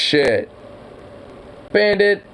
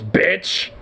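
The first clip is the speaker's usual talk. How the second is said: normal talking voice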